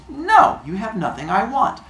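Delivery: put-on high, emphatic kid's no; lightly acted defiant child; bratty refusal. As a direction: turn him down flat as a child. Hermes refuses Apollo's trade.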